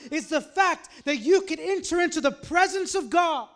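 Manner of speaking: loud, high preacher's delivery building to a shout; full-force preaching. Real, not acted. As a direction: preach it with rising force and drawn-out key words.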